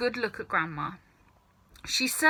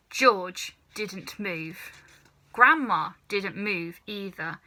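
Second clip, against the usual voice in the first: ordinary delivery, nothing marked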